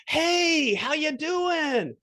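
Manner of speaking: drawn-out, slow hello from across the restaurant; calling across the room. Retold or imitated. Friend spots you across a restaurant, calls out a slow, high greeting. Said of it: "you hear a voice from across the restaurant".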